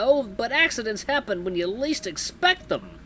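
hammy mobster voice; TV gangster. Acted. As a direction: threaten with fake friendliness like a gangster. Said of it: "let me channel the gangsters".

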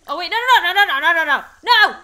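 high, loud panicked string of no; frantic, watching it go wrong. Real, not acted. Candle blowing goes wrong.

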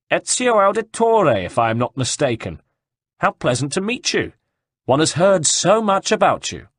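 high, projected, sardonic greeting; mock-courteous surprise. A narrator's reading. Horseman greets Ezio up on the wall, mock-courteous. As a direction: make a show of being pleased to meet him. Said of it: "looked up at him in surprise".

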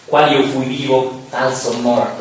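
loud, slow declaimed cry; grido declamato. Acted. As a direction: proclaim it proudly, drawing out the first half. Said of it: "gridò". (grido fiero, declamato lentamente)

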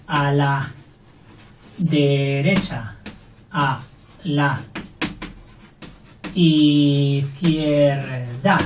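A la derecha, a la izquierda said very slowly with pauses; a slow, stretched-out derecha and izquierda; slow dictation. Shown as a demonstration. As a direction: dictate word by word. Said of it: "lo mismo".

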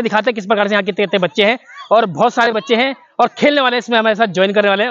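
usual speaking voice

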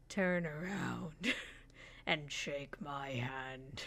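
deep voice. Acted. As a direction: loom over the human in a slow deep rumble. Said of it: "I'm gonna do deep voice".

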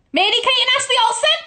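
very fast, high shriek; loud squealed eureka; excited realisation. Real, not acted. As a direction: scream out the answer as it dawns. Suddenly gets the answer.

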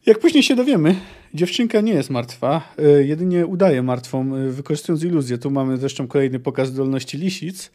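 ordinary talk, nothing marked

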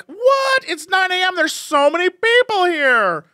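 high, amazed mom voice; mock outrage at the crowd; shocked mom. Mom shocked at the packed Costco, high amazed voice. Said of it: "my mom's like".

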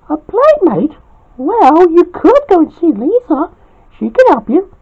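high, helpful wife's voice; Terry's voice. Acted. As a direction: keep it high and suggest it kindly. Terry suggests Lisa on phone.

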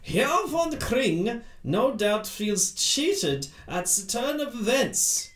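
put-on heavy German accent, drawn out. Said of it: "sorry, that was Sherlock Holmes".

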